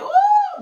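sudden high preacher's whoop; high ecstatic whoop. Real, not acted. Preacher whooping to the congregation, high joyful cry. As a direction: cry out in joy.

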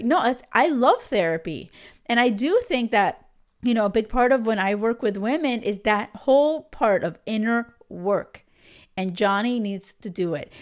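ordinary talk

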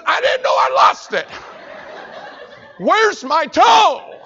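high, loud, slow yell; panicked yell. Retold or imitated. Patient on the gurney yelling at the doctor in panic. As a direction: cry out for the lost toe.

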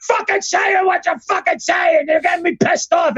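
high, shouting boss tearing into an employee; mock furious yelling. Boss yelling at his announcer, mock shouting high. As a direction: yell it like Vince losing his temper. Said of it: "yelling at him".

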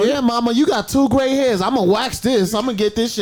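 loud, high playful bravado to mama; hammed-up eager announcement; mock gung-ho. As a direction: act out an over-eager helper. Acts out waxing mother, mock bravado.

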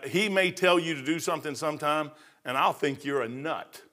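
usual speaking voice

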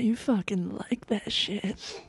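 slow breathy whisper; sexy voice. Pretend. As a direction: whisper it seductively, slowly. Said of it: "what if I do my sexy voice".

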